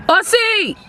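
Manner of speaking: high-pitched call across the field. Real, not acted. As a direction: call his name to get his attention. Calling out to Aussie on field.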